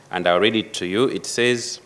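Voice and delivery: ordinary talk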